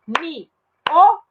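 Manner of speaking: "miolo" very slowly as mi... o... lo; teacher's slow syllable split of "miolo". Shown as a demonstration. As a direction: separate i from o in "miolo" with pauses. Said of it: "eu separei".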